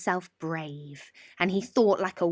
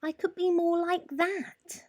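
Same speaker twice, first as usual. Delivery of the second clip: 1 wistful mouse; 9 semitones higher than usual. A narrator's reading. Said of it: "thought Mouse".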